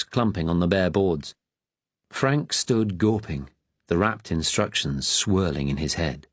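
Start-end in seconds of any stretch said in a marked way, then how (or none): none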